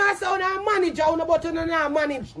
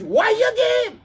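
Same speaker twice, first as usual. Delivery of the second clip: loud, high, shouted question; exasperated raised-voice question; angry challenge; 7 semitones higher, 4 dB louder than usual. Real, not acted. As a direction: demand it at a shout. Angrily challenges the manager.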